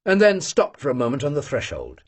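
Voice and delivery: plain reading voice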